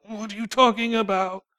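a touch of defensive pushback; indignant flesh. Retold or imitated. James's flesh resisting correction, mock indignant retort. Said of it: "my flesh was still like".

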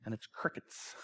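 breathy whispered aside; whispered deadpan crickets; whispered punchline. Acted. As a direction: drop to a whisper for the joke. Punchline whispered after a silence.

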